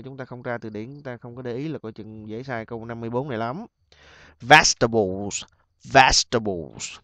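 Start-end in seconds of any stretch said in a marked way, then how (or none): 4.4-7.0 slow Vegetables, first syllable punched; stress on first syllable. Shown as a demonstration. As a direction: lean on VEG in Vegetables, then fall away. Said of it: "Vegetable âm thứ nhất ha". (nhấn mạnh âm đầu Vegetables, đọc chậm)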